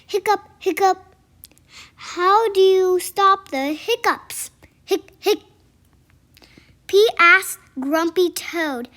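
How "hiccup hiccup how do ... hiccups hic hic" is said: hiccuping. Acted. Pete has the hiccups.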